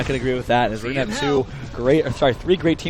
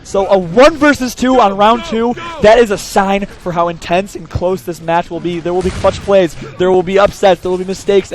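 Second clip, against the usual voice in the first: hype announcer; 3 semitones higher, 7 dB louder, slower than usual. Real, not acted. Caster hypes the match.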